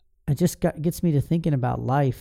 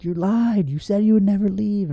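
angry accusation; 7 semitones higher than usual. Retold or imitated. Twelve-year-old son accusing his leaving father, angry and high. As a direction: throw it at him like a betrayed kid. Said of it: "and I got angry".

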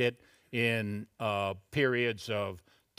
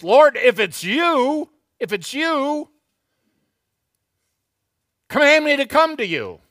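Peter; 12 semitones higher, 10 dB louder than usual. Acted. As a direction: call across the storm to the Lord. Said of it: "So Peter goes".

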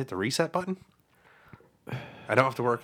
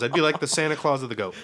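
ordinary talk, nothing marked

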